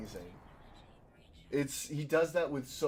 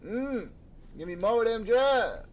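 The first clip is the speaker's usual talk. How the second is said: high, louder greedy voice; mock craving; 9 semitones higher, 5 dB louder than usual. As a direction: beg for the drugs like a junkie. Jokey craving drugs.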